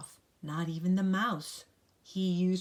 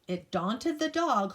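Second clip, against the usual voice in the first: ordinary delivery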